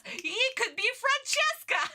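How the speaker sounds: high squeal tipping into laughter; laughing excitement. Real, not acted. Streamer casting villain as whip girl, gleefully laughing. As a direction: blurt it gleefully as you crack up.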